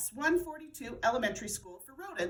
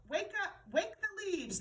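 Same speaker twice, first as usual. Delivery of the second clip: timid command; 8 semitones higher, 3 dB quieter than usual. A narrator's reading. Wadney mispronounces orders.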